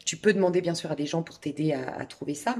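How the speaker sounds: normal talking voice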